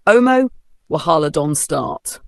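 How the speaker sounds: ordinary delivery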